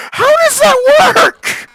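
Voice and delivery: high, slow, laughing disbelief. Real, not acted. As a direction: ask it while cracking up. Laughing at glitchy boot.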